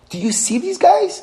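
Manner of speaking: voicing the sea. The sea voiced complaining to God.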